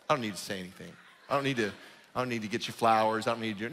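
low, monotone excuse-making; mock indifference in a low, level mumble; dismissive husband. Husband brushing off saying love to his wife, low dismissive drone. Voicing himself as a lazy husband.

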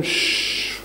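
long soft "shhh"; quiet them with a hiss of shh; voiceless hush; hushing shush. Acted. God hushes the accusing angels.

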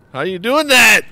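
high, loud incredulous cry; amazed cry. Real, not acted. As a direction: demand it in disbelief. Amazed at friend's trick.

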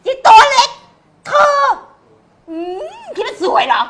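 high, loud sneering villainess voice; soap villainess. Acted. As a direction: sneer down at the heroine, shrill and loud. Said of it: "เดี๋ยวเป็นนางร้าย".

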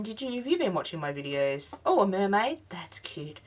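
usual speaking voice

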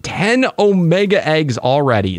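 ordinary talk

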